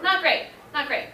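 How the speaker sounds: dry wry understatement; deadpan, a little sardonic. Real, not acted. Wry repeat after dark story.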